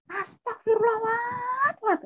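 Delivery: very high-pitched horrified wail, imitating older sibling; shocked outcry. Older sibling hears of the conversion, high horrified outcry. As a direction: cry out scandalised. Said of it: "jangan teriak-teriak".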